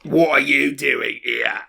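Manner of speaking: slow, rough orc growl. Acted. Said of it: "That was a good orc voice".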